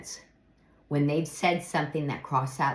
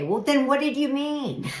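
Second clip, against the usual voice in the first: higher, exasperated comeback; mock incredulous; 10 semitones higher, 4 dB louder than usual. Answering someone who dodges blame, mock exasperation. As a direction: throw it back, half laughing. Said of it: "what are you gonna say".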